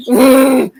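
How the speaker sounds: loud growling roar; imitated angry roar. The juvenile heaving the log at the car, roar. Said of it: "and then he just".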